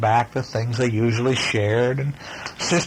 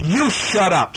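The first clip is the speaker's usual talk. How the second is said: higher, sharp snap; snapping sister; 7 semitones higher, 3 dB louder, slower than usual. Acted. Sister snapping at her brother. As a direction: cut him off hard. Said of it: "snapped Sister".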